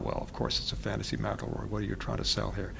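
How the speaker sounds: softly voiced sceptical reader, well under normal volume; quiet mock aside. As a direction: toss it off under your breath.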